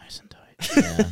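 whispered, drawn-out echo; breathy whisper. Acted. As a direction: breathe the words slowly. Mocking echo of a catchphrase.

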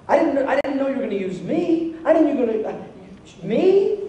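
flustered, imitated astonishment; acted disbelief. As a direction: stumble toward a high, incredulous 'me'. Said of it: "He's like".

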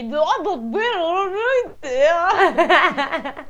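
high, slow mock-innocent wife; feigned innocence. Wife playing innocent about the butter, high and slow. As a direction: play dumb sweetly, voice up high. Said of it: "Lei si finse non meno sorpresa".

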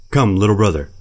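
calls up into the trees, loud; calling out. Acted. Panther calls loudly up to the boy in the tree. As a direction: call the boy down from the branches.

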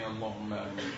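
ordinary talk

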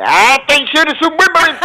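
very high mock yell; imitating catchphrase. Showing how the disguised guest imitated the other, high mock yell. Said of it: "lo imita al otro".

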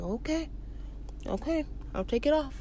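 very soft, hesitant okay; meek compliance. Retold or imitated. Man gives in to security guard, meek. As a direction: act out giving in, barely audible.

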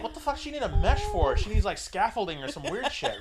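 high-pitched baffled outburst; mock incredulity. Viewer reacting to transvaginal mesh commercials, mock incredulous. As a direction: pitch it up in comic disbelief.

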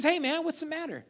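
high, easygoing "hey, man" question; acted casual, friendly inquiry; casual concerned check-in. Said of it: "I like to read it like this".

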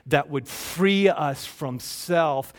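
slow, stretched-out emphasis on free; slow emphatic phrase. Real, not acted. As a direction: lean into each word. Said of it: "preacher slows to emphasize".

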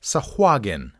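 "Sahuagin" with stressed hwah, hard g; three-syllable suh-HWAH-gin; correct pronunciation. Shown as a demonstration. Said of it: "it is Sahuagin".

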